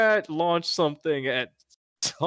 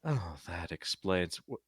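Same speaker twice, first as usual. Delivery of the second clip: quiet, unsure reply; 7 semitones lower, 8 dB quieter than usual. Acted. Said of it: "So the response you get is".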